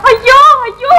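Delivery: wife cries out at talk of death, sobbing wail; wailing cry. Acted. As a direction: wail it out in despair. Wife wails over husband's death talk.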